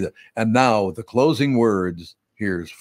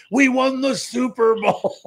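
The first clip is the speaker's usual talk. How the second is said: put-on high, slow excited child cheer; excited kid; 13 semitones higher than usual. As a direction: shout it like a proud six-year-old. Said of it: "running around with his hands".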